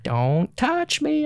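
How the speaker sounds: high, very slow, eerie warning drawn out; creepy voice. Retold or imitated. Girl actor in the haunted house warns him off, slow creepy voice. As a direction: make it unsettling, each word stretched. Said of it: "real creepy voice".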